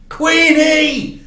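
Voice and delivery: high, loud excited call; boy hearing his dad's car; excited cry. Acted. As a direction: shout her name in delight. Boy hears his father's car.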